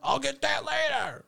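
high-pitched holler over machinery; mimicking a shout over the snowblower; imitated yelling. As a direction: call it out across the yard.

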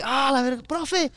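put-on high, pained kid calling the teacher; whiny complaint. Kicked boy whining to the teacher, high and pained. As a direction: cry out to the teacher after the kick.

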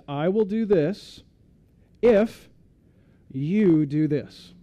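slow, pause around if; stress on IF. Acted. Conditional covenant quoted.

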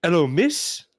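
British customer hailing the shop owner; British accent. Acted. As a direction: call out in a put-on British accent. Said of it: "We're gonna try our British accents".

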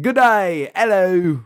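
high, loud Aussie greeting; mock Australian accent. Playing along with cohost's teasing, high loud Australian accent. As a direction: call out a hammed-up Australian hello. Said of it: "That's Australian".

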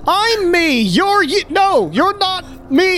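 high-pitched outraged yell. Acted. Man locked out of his own house, yelling. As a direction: shout it high, like someone locked out. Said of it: "and he's yelling".

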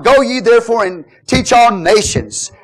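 usual speaking voice

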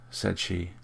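plain reading voice

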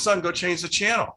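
put-on a father telling his boy to switch channels; dad's order. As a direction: bark it like a dad sending his son. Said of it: "He says".

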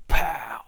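breathy voiceless pow for the slap; slap sound. Retold or imitated. Retold slap from older brother, a voiceless pow. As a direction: spit out the slap noise.

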